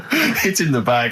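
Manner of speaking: laughing. Real, not acted. Laughing at award joke.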